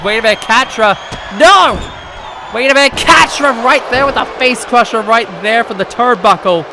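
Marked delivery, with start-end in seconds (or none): 1.4-6.6 excited shout. Real, not acted. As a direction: yell it as the move lands. Shock at face crusher spot.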